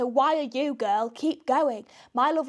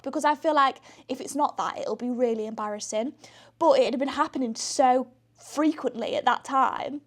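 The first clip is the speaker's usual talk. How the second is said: ordinary delivery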